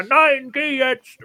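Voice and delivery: firm dismissal. Retold or imitated. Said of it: "Und dann sagt, äh, Saraya".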